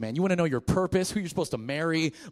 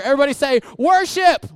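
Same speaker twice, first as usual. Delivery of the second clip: high, loud call-out; rally the crowd; 12 semitones higher, 13 dB louder than usual. Real, not acted. Pastor leads a call and response, loud and high.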